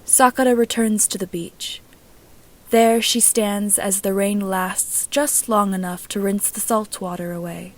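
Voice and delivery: usual speaking voice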